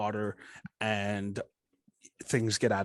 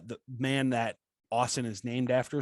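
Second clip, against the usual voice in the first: ordinary talk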